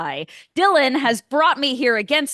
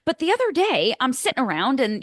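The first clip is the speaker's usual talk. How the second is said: ordinary delivery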